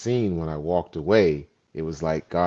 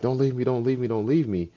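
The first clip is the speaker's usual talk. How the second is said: higher-pitched mock pleading; imitated crying plea; 7 semitones higher than usual. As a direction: lightly imitate someone begging through tears. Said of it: "he's crying and crying and he's like".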